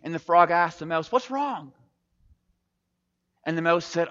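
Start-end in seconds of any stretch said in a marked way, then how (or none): 1.1-1.7 high-pitched acted concern; worried friend's query, pitched up; concerned question. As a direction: ask a friend with alarm, voice lifted. Frog asks his distraught friend.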